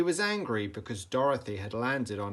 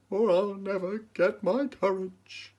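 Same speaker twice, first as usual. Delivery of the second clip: lion resolving; 9 semitones higher, 4 dB louder than usual. A narrator's reading. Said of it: "said the lion".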